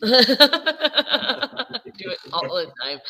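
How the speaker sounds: ordinary talk, nothing marked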